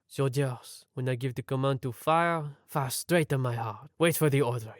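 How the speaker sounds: put-on a modest French accent, calm and steady. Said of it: "apologize for my accent".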